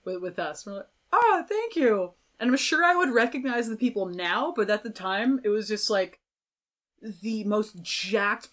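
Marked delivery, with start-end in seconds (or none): 1.1-2.1 delighted thanks. Retold or imitated. Grateful reply to wrestlers.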